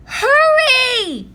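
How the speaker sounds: high, loud urgent shout. A narrator's reading. Annie yelling at her brother to run, loud and high. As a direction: yell to him to hurry. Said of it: "shouted Annie".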